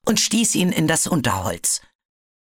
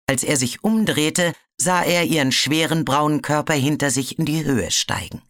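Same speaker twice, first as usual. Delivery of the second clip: ordinary delivery, nothing marked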